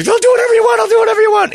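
high, loud, terrified begging; panicked pleading. Retold or imitated. Suspect begging police while the dog mauls him, high panicked yell. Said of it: "And he's laying there".

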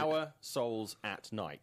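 "Our Souls at Night" slowly, word by word. Shown as a demonstration. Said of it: "Say it, say it slower".

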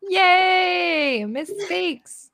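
high, squealing cheer; excited cheer. Real, not acted. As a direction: squeal with delight. Celebrating the pun rebrand.

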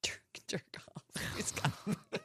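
breathy conspiratorial whisper. Real, not acted. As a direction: whisper it like a secret. Whispered naughty answer.

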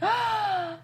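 high, drawn-in gasp; delighted gasp. Real, not acted. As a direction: gasp at something adorable. Reacting to a cute episode.

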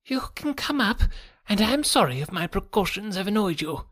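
high, reedy apology; jangled nerves. A narrator's reading. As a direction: apologise in a thin, nervous voice. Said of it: "as well as his voice, testified to his jangled nerves".

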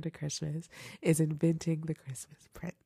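soft whisper on the title; whispered reveal. Real, not acted. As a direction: hush it like a precious secret. Revealing number one favourite.

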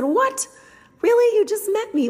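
a squeal of happy shock; surprised disbelief. Retold or imitated. Shocked by child's compliment.

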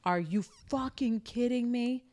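slow, sneering mock brother; brother's disgusted reaction on the phone; imitating angry brother. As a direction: drag it out with contempt. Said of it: "And he's like".

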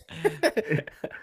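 high breathy laugh at a failed accent; laughing at himself. Real, not acted. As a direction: burst out laughing at your own try. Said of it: "I am so bad at impressions".

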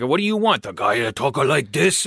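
broken English stock accent; mock immigrant accent, thick. Shown as a demonstration. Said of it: "That was good".

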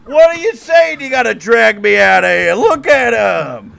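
put-on very high, loud, slow heckling shout of a drunk hooligan; Irish hooligan heckler. Time-traveling hooligan heckling Lincoln at the debate, shrill shouting. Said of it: "Irish hooligans".

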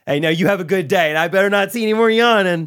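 high, loud sugary warning; sweet on top, threatening underneath; imitating the overly friendly gate agent's cheerful threat; mock friendly agent. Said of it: "this type of person".

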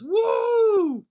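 long, high, loud "woo"; imitated whoop. Muscle Man running off after his prank, whooping.

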